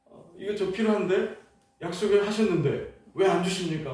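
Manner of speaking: resentful, irritated prayer. Retold or imitated. Young man grumbling at God over the missing bookcase, irritated. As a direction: whine at God with annoyed resentment. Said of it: "원망과 짜증 섞인 말로 막 기도했습니다".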